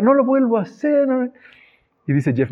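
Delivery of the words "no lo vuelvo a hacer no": put-on high, pleading little boy's voice; crying child. Little son pleading with his dad, high and whiny. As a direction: beg like a tearful child caught misbehaving. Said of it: "Y empieza a llorar".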